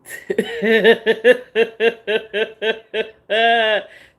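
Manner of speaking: long loud laughing fit; loud laughter. Real, not acted. Laughing at her own story, loud laughter. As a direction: break up laughing.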